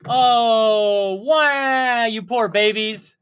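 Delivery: mock sympathy for crying babies; mocking baby talk. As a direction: sneer at them like crybabies. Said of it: "Quit crying".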